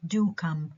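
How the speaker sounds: "do" weighted, then a falling "come"; stress on DO. Shown as a demonstration. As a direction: invite with emphatic "do". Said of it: "عملت stress على do".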